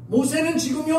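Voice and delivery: ordinary delivery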